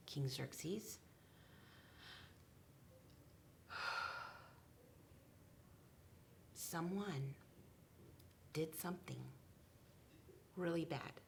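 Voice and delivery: soft hushed pleading whisper; fearful whisper. Acted. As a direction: whisper it as if afraid to speak. Esther whispering to the king.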